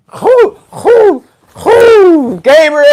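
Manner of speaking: very high, loud incredulous hooting; high-pitched, loud astonishment. Retold or imitated. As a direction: squeal the question in mock disbelief. Acts out his baffled disbelief.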